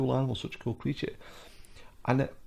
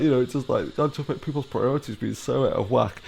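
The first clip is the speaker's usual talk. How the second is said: normal talking voice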